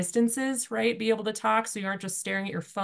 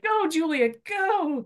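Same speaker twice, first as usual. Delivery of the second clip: high-pitched mock cheer to hurry up; mock urging on; 12 semitones higher, much slower than usual. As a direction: egg yourself on in a much higher voice. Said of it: "and it was like".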